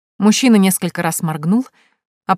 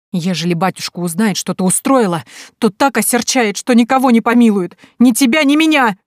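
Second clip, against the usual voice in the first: rising, louder tearful warning; tearful fear; 5 semitones higher, 5 dB louder than usual. Acted. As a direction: build from worry to a weeping cry. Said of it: "Купчиха снова залилась горючими слезами". (со слезами, всё громче)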